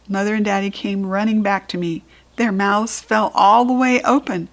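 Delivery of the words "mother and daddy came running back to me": plain reading voice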